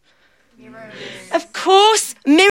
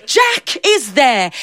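ordinary delivery

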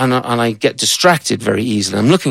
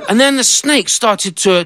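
normal talking voice

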